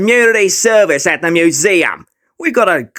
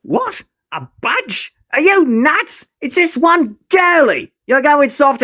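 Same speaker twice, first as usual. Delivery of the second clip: scoffing; 8 semitones higher, slower than usual. Acted. Bully scoffs at his sidekick.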